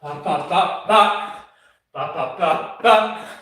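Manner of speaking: rhythmic "ba" sounds. Real, not acted. Athlete mid-workout, rhythmic vocal "ba" noises.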